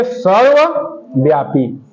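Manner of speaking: सर्वव्यापी split into सर्व, pause, व्यापी; low, slow सर्व ... व्यापी for note-takers; slow dictation in parts. Shown as a demonstration. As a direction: say सर्वव्यापी slowly in two separate halves. Repeats answer in parts for class.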